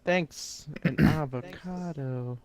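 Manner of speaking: silly voice. Pretend. Silly voice gift thanks.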